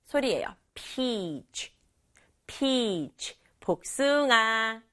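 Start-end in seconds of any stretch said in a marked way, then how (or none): 0.7-3.4 "peach" with a long, drawn-out ee; careful model "peach" with lengthened vowel. Shown as a demonstration. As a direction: stretch the ee in "peach", then finish on ch. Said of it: "이 하고 길게".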